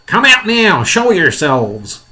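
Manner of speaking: loud order, high start, drawn-out end; shouted order. Acted. Police chief ordering the creatures out, loud shout. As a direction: shout a command up to someone far above. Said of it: "shouted the police, chief of police".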